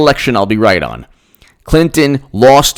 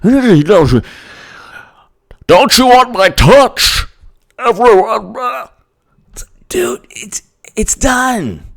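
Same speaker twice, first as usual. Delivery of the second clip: high, slow creepy coo; high drawn-out teasing voice; mocking creepy Biden; 9 semitones higher, slower than usual. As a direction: coo it like a creepy old man.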